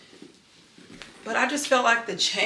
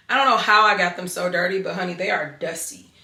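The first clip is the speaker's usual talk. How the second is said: ordinary talk, nothing marked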